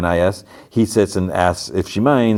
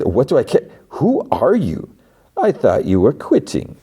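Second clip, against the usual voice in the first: higher, hammed-up indignant questions; mock outrage; 7 semitones higher than usual. Mark snapping back at her, hammed-up outrage. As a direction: play his outrage a bit over the top. Mark's retold angry reply.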